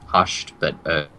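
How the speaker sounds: ordinary talk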